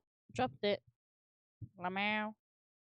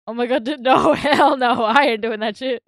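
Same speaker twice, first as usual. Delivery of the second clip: loud laughing hell no; much louder, cackling no way; laughing refusal; 2 semitones higher, 13 dB louder than usual. Real, not acted. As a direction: burst out laughing while refusing.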